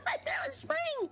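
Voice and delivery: high-pitched, laughing through the words; words riding on a high laugh; real laughing. Real, not acted. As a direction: laugh helplessly while repeating yourself. Laughing at her own packing mistake.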